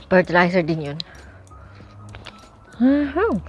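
talking while eating fruit; words muffled by chewing; mouth full, chewing. Real, not acted. Seen 2: Eats strawberries from bowl throughout, biting and chewing between words.

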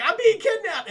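high, loud mock-panicked yell; freaking out. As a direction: play a hostage's panic, hammed up. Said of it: "So he's freaking out".

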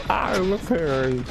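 high, drawn-out playful repeat; playful singsong. Real, not acted. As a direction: stretch it out high and silly. Playful repeat while repairing.

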